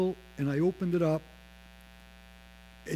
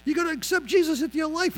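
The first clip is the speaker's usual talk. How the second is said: high, loud, eager rush to pray; hammed-up excited urging; 11 semitones higher, 4 dB louder than usual. Sick friend urging him to pray, excitedly. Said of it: "But he was all excited".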